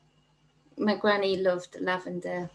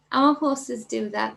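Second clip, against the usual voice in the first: ordinary talk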